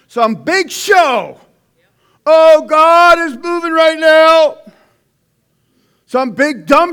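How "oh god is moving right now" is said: higher, louder, slow showman's cry; mock TV preacher. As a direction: ham up a televangelist proclaiming a miracle. Mocks showy faith healer.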